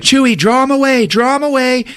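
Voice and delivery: high, loud, imitated urgency; Rey's urgent call. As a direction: call out orders to the pilot. Rey calling urgently to Chewie.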